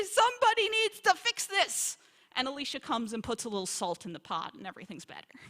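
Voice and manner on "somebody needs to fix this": higher, louder hammed-up alarm; a hammed-up outcry over bitter stew; mock panicked cry. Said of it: "they say".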